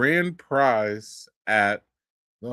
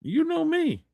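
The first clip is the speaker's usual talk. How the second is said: mock surprised greeting, pitched much higher; excited reunion; 14 semitones higher than usual. Retelling bumping into Caleb at a brewery, excited. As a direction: play two old acquaintances spotting each other. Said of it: "we're just like".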